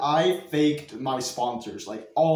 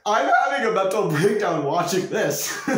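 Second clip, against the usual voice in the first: higher, laughing exasperation; 6 semitones higher, 4 dB louder than usual. Real, not acted. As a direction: laugh it out, louder. Laughing at old self.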